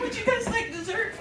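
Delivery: very high mock-tearful waitress; squeaked-out weepy question, played for laughs; crying. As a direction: offer it while pretending to sob. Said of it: "cannot stop crying".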